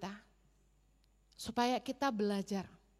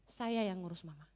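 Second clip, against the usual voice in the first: soft, lower reassuring promise; tender promise; 4 semitones lower, 6 dB quieter than usual. Retold or imitated. Preacher quotes his promise to his mother-in-law, tender and soft. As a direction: soften and reassure her gently. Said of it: "Saya bilang".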